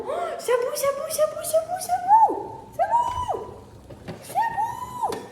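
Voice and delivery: a rising squeal as the pot boils over; squealing panic. Real, not acted.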